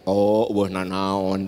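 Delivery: lightly voiced oblivious husband; clueless husband. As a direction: drawl it like a husband who missed the hint. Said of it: "Cek Bapak teh".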